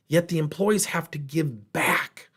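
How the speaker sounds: ordinary talk, nothing marked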